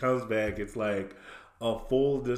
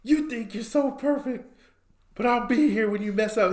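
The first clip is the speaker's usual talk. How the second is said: high-pitched unhinged cartoon rant; mock paranoid accusation; psychotic Squidward; 12 semitones higher than usual. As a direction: accuse them in a high, crazed voice. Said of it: "he's, like, psychotic now".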